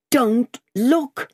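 high, alarmed warning; desperate cry. A narrator's reading. Lorry as the doctor reaches for the window, desperate. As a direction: cry out desperately to stop him. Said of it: "absolutely desperate".

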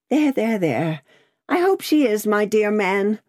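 high, flustered hushing; distracted cry. A narrator's reading. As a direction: cut in, mildly frantic. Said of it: "cried the distracted Miss Pross".

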